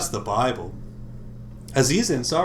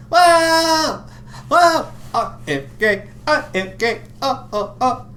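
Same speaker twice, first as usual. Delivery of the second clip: Aziz Ansari impression; 13 semitones higher, 5 dB louder, slower than usual. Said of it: "say it like Aziz Ansari".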